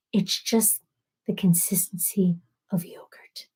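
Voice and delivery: hushed, soft aside dropping to a whisper; mock ASMR whisper. Imitating an imagined comment about yogurt, hushed whisper. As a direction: whisper it softly and deliberately.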